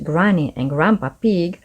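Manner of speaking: ordinary delivery, nothing marked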